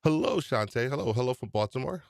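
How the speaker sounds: normal talking voice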